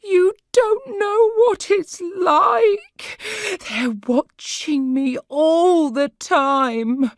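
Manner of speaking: high, loud sobbing words, slow; crying. A narrator's reading. Sarah cries to Corrie about being watched. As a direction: cry it out in panic. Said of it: "burst into tears".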